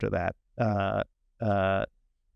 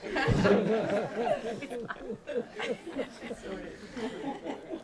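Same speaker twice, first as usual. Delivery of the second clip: high laugh; 13 semitones higher, 5 dB quieter than usual. Real, not acted. Panel laughs at snort joke.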